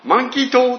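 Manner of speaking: hammed-up confused American mishearing; puzzled Americans. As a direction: imitate Americans mishearing the name. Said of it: "彼らは".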